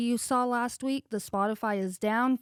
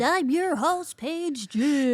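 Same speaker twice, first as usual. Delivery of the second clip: host intro; 4 semitones higher, much slower than usual. Acted. Sing-song show intro.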